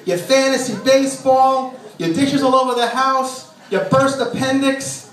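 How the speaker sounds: mock tirade pitched high and slow; yelling wife. Wife yelling at husband over his habits, a high slow mock tirade. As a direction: rant at him, high and drawn out, every item a complaint. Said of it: "She's always yelling at me".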